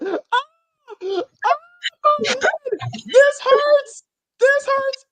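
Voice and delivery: high, loud mock wail of pain; hammed-up actress in pain. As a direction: overact the agony, shrill and loud. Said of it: "this actress has to be like".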